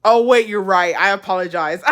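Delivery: ordinary delivery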